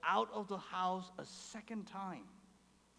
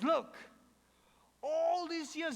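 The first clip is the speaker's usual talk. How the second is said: higher, resentful protest from the eldest son; complaining son; 9 semitones higher, 5 dB louder, slower than usual. Retold or imitated. Resentful eldest son complains to his father, raised and aggrieved. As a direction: voice the son's grievance, a bit pushed up. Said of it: "he complains to his father".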